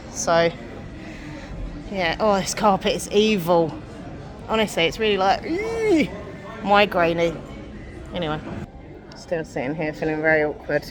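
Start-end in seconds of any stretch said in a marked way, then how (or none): 5.4-6.1 high-pitched drawn-out "ee"; little high squeal of discomfort; queasy squeal. Shown as a demonstration. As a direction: squeal as if the sight makes you wince. Noise showing the carpet's effect.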